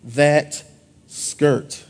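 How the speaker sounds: low, slow admiring compliment; flirty husband. Retold or imitated. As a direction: drawl it low and slow at your wife. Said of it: "look at your wife and say".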